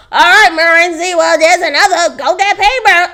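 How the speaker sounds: playful put-on agitation, pushed up and loud; agitated coworker. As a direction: imitate a coworker jokingly agitated. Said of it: "she's doing it in an agitated voice too".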